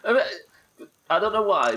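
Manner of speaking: high loud yelp on know; odd squeaky outburst. Real, not acted. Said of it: "I don't know what that sound was I just made".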